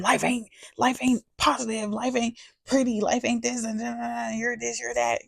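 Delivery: mocking critics. Mocking her critics' complaints.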